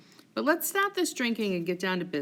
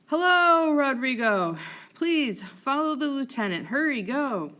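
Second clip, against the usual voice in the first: calling out; 6 semitones higher, 5 dB louder than usual. Acted. Iago calls out to Rodrigo.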